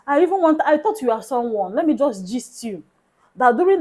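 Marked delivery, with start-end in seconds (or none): none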